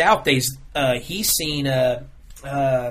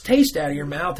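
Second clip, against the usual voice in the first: ordinary talk, nothing marked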